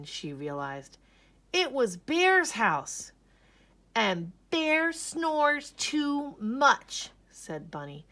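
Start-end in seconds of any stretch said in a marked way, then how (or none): 1.5-3.1 slow, higher, louder dread; higher, slow, fearful announcement; dramatic alarm. A narrator's reading. Scary realization. 3.9-7.1 very slow, high, drawn-out complaint; high, loud, very slow whine; exasperated bunny. A narrator's reading.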